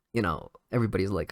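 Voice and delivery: usual speaking voice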